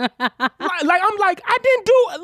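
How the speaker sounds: high-pitched indignant pleading; high protest. Retold or imitated. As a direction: whine your innocence up high. Protesting to teacher.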